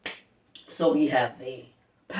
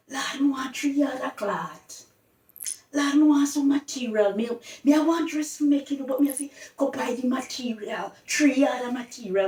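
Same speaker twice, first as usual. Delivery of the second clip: raised, higher and louder, a woman fussing about cloth; quoted Jamaican woman; 6 semitones higher, 3 dB louder than usual. Retold or imitated. A woman calling for material, pitched up and louder. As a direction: call out for cloth like a market shopper. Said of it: "When you hear they talk about".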